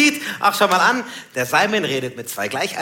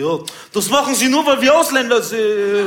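imitating the customer; 6 semitones higher, 4 dB louder than usual. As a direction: put on his voice, dragging out the complaint. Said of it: "Da meinte er". (nachgemachter Kunde, höher und langsam)

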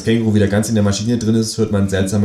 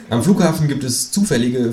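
ordinary delivery

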